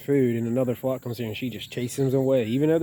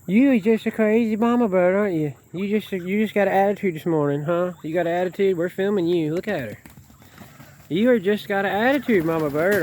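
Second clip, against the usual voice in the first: high, louder, mock-scolding voice; teasing pet talk; 7 semitones higher, 4 dB louder than usual. Real, not acted. Teasing mama bird about her attitude, high mock scold.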